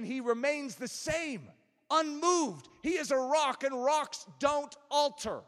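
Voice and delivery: loud, high, driving sermon delivery; forceful preaching. Real, not acted. Preacher tells congregation God is an unmoved rock, forceful preaching. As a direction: hammer each point home from the pulpit.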